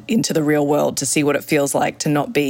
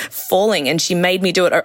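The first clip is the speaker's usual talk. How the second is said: normal talking voice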